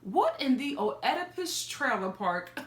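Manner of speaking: ordinary delivery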